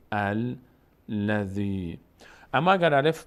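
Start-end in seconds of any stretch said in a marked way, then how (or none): 0.1-2.0 slow الذی starting on a clear "al"; opening fatha. Shown as a demonstration. Teacher shows starting the verse here, opening fatha. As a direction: begin الذی with an a, drawing it out.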